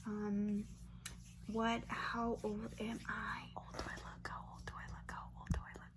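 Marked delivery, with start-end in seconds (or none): none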